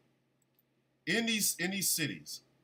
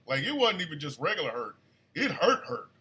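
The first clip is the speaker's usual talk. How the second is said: normal talking voice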